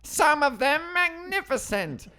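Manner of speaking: very high, loud, slow cartoon villain screech; Skeletor voice. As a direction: cackle it out like Skeletor. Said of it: "Now do the rest as Skeletor".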